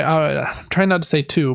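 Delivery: ordinary delivery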